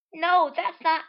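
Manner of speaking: high, loud cut-off denial; high sharp objection; loud protest. Acted. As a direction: snap back in denial. Marina protests being read.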